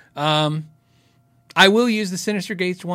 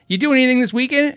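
higher-pitched imitation of a barista; imitated server greeting, pitched up; coffee shop worker; 9 semitones higher, 4 dB louder than usual. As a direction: put on a friendly barista's small-talk voice. Acting out coffee shop worker's questions.

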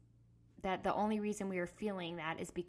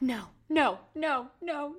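loud, higher-pitched panicked refusals; freaking out; 7 semitones higher, 9 dB louder, slower than usual. Retold or imitated. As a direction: repeat it in rising, re-enacted panic. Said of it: "I started to freak out".

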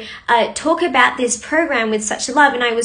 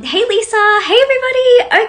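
high, louder greeting; excited hello; 10 semitones higher, 6 dB louder than usual. Real, not acted. Host welcoming Lisa and everybody, high and excited. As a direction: sing out the hello.

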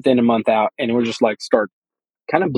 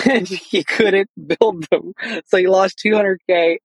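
higher voice, laughing through the words; 6 semitones higher, slower than usual. Real, not acted. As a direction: tell it through real laughter. Laughs while telling friend's costly mistake.

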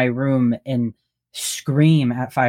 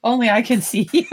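high, quick words through laughter; amused and breaking into a real laugh; 9 semitones higher, faster than usual. Real, not acted. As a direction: giggle through the line, pitched up. Laughing at the off-camera slip.

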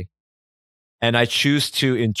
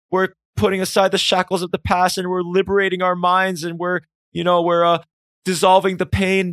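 much higher, gushing enlightenment rant; hammed-up euphoric crowd speech, pitched high; ecstatic mock sermon; 8 semitones higher than usual. Imagined crowd catharsis speech.

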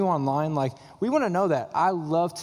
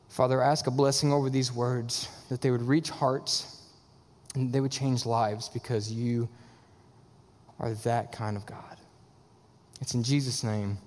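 low, hushed, earnest prayer; closing prayer; 5 semitones lower, 4 dB quieter than usual. Real, not acted. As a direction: bring the prayer down softly to a reverent amen. Closes a prayer.

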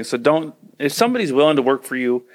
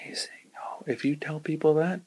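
breathy gasp, then whispered aside; 2 semitones higher, 10 dB quieter than usual. Real, not acted. Host whispering an aside to listeners, hushed. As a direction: drop to a secret whisper.

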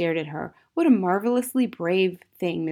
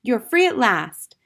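put-on high, loud, drawn-out child's joy; jubilant cry; 5 semitones higher, 7 dB louder, slower than usual. A narrator's reading. Matilda rejoicing at her teacher's freedom, crying out. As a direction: exclaim it slowly and triumphantly. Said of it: "Matilda cried".

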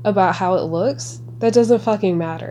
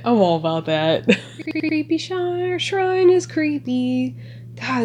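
usual speaking voice

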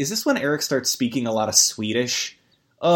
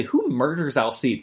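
normal talking voice

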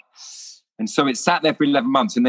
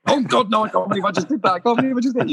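high squeaky self-reproach; embarrassed high-pitched cry; mock horror; 11 semitones higher, faster than usual. Real, not acted. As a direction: yelp it high in mock panic at yourself. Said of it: "You just can't help it".

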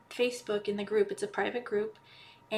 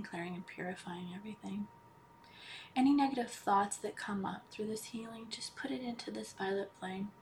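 guided meditation; 8 dB quieter than usual. Acted. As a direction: ease them into calm, almost whispering. Leads a healing meditation.

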